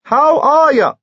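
very high, loud repeat; shouted greeting. Acted. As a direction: make yourself heard by someone who missed it. Shouting the greeting back at Lucy.

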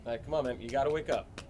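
usual speaking voice

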